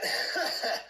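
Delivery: breathless strained laugh; huffing laugh through effort; painful laugh. Real, not acted. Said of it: "I'm laughing through it".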